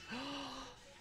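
sharp high gasp of delight. Retold or imitated. Fan gasping at the Metroid mask, high gasp.

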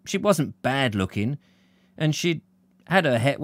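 plain reading voice

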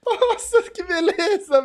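high, loud chant building to a delighted cry; hyped by a spinning multi-kill; thrilled cheer. Real, not acted. Fan chanting as the player spins through kills, high and thrilled.

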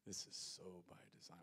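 a quiet inner thought of Jesus; hushed echo. Retold or imitated. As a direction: let it sink in almost to yourself. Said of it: "here's what Jesus is thinking".

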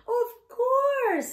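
high, loud joyful call; eager happy cry. Acted. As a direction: shout yes together gladly. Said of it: "said ... together".

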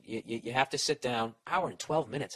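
ordinary delivery

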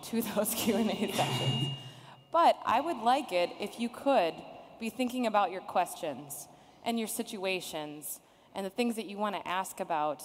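ordinary delivery

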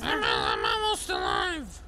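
throat-wrecking character shout; slow, strained, harsh creature voice; Hobbit. Pretend. Hurt patient calling out to the medic, harsh strained voice. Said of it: "Dude, Hobbit, it wrecks your fucking throat".

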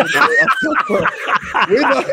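words breaking into high laughter; can't get the line out for laughing. Real, not acted. Co-host laughing at his own slip on the podcast. Cracks up over the podcast name.

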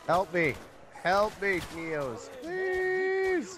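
high loud desperate plea; pleading shout. Real, not acted. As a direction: beg for help at the top of your voice. Downed, begging teammate for help.

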